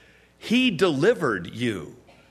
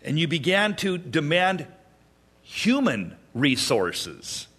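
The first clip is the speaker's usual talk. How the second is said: ordinary talk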